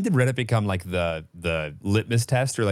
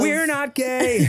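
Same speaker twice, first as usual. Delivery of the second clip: high, drawn-out ironic denial; mock protest; 13 semitones higher, 3 dB louder, much slower than usual. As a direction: stretch it out, high and sarcastic. Ironic echo of partner.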